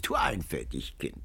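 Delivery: soft, low teasing; low mocking. A narrator's reading. Old woman teases Antonio, low and quiet. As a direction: chide him quietly. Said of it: "Die Alte kicherte und lachte".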